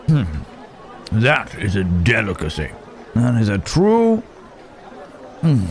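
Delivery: ordinary delivery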